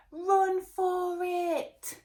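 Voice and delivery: loud, high, slow cry of panic; high frantic yell; panicked. Acted. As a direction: shout a warning in panic. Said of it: "panicked Pepper".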